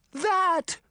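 sudden high-pitched yell; high alarmed exclamation; shout. A narrator's reading. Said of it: "the girls shouted".

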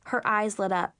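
ordinary delivery, nothing marked

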